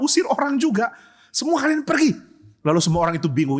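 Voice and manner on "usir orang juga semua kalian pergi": high-pitched, agitated voice; bewildered protest. Acted. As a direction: echo an angry expulsion in disbelief. Bewildered father retelling angry expulsion. (heran, nada tinggi)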